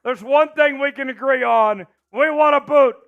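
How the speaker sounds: loud high party hype; frat bro hype. Real, not acted. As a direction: pump up the crowd like a frat party. Hyping donor, beer bong.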